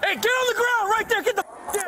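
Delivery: high, urgent barked commands; shouting orders over battle. Real, not acted. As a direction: yell it over chaos. Officer yelling at suspect.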